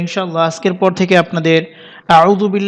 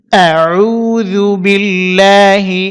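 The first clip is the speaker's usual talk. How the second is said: slow, drawn-out Quranic recitation; much slower than usual. Shown as a demonstration. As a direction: recite it slowly, stretching each sound. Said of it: "আবারও বলছি". (ধীরে তিলাওয়াত)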